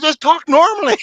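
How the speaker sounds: high, loud pleading protest; mock exasperation. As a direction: beg him in exaggerated disbelief. Acting out baffled plea to actor.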